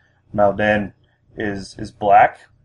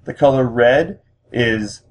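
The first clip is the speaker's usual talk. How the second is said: usual speaking voice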